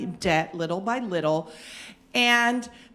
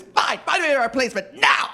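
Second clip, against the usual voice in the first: curt boss; 5 semitones higher, 3 dB louder than usual. Retold or imitated. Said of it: "he's so red".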